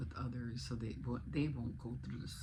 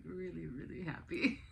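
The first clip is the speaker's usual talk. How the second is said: laughing happily; 3 semitones higher than usual. Real, not acted. Laughing about son's success.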